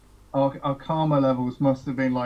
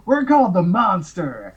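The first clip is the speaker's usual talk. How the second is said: loud, higher, drawn-out proclamation; imitated bandmate's announcement; 5 semitones higher, 6 dB louder than usual. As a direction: belt out the band name, slowly. Bandmate blurts out the band name.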